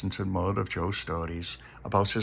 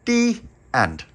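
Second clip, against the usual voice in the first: high, loud, sing-song the end; story ending; 9 dB louder than usual. A narrator's reading. Storyteller closing the bedtime tale. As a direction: announce the finish brightly.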